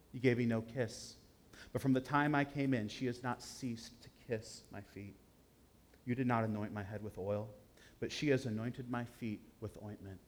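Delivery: soft, low reading of the gospel; scripture reading. Acted. Reads scripture aloud.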